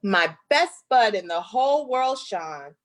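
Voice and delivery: lively, sing-song picture book reading; reading to children. Acted. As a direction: read it like a rhyming story to little kids. Reads picture book aloud.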